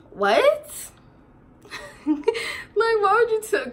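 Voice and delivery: high, laughing disbelief; incredulous. Real, not acted.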